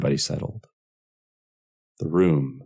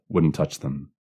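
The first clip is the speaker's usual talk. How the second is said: ordinary delivery, nothing marked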